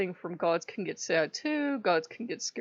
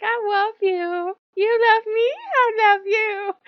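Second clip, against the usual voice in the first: much higher, louder, slow pleading; hammed-up tearful declaration; mock needy god; 12 semitones higher, 9 dB louder, slower than usual. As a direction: gush it like a clingy child. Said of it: "He was like".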